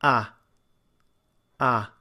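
"ah" between cat and father vowels; Irish-style "ah"; in-between ah demo. Shown as a demonstration. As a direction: sound the in-between "ah". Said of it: "And this is".

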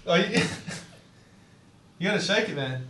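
ordinary talk, nothing marked

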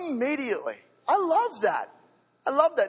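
light up over the word; excited delight. Real, not acted. Preacher thrilled by the sudden healing, excited. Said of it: "excited exclamation".

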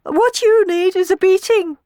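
very high, loud rage; shrieky, threatening scolding; fuming. A narrator's reading. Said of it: "fumed Tisby".

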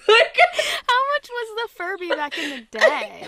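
loud, high, laughing question. Real, not acted. Co-host reacting to a toy's price, laughing question. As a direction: ask it through real laughter, drawn out.

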